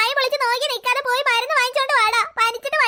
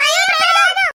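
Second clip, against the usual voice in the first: high, loud scream of fright on waking; terrified scream; 7 semitones higher, 6 dB louder, slower than usual. Acted. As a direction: shriek at the ghost. Wakes and screams at ghost. (പേടിച്ചുള്ള ഉച്ചത്തിലുള്ള നിലവിളി)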